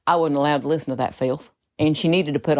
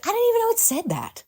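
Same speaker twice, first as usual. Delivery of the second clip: high-pitched; faster than usual. Retold or imitated. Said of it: "But I was like".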